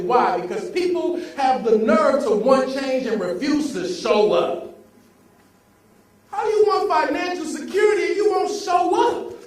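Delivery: loud, driving motivational preaching; rally the room like a revival; preaching at full force. Acted. Motivational speaker firing up the audience, loud and preachy.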